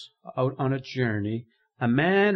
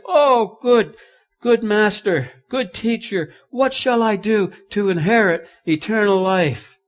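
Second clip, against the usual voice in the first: pleading ruler; 9 semitones higher, 10 dB louder than usual. Acted. Ruler begging Jesus.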